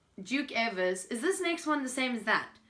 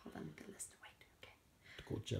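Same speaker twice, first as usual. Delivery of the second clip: whisper; 18 dB quieter than usual. Real, not acted. Aside while checking the list.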